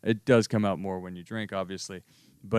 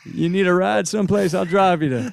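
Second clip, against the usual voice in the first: higher, louder, laughing Texas charm; hammed-up Texas accent; 7 semitones higher, 7 dB louder than usual. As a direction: lay on a thick Texas accent to woo a girl. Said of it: "I could always ham it up for you".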